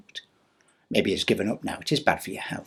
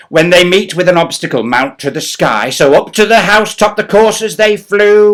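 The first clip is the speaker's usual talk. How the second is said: louder, higher excited reading; dramatic story narration; 5 semitones higher, 13 dB louder than usual. Acted. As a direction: read the tale with gusto. Poem narration.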